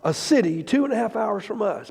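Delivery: loud, high, impassioned preacher; fired-up sermon, ringing with emotion; full-force preaching. Acted. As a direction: preach it out to the whole room.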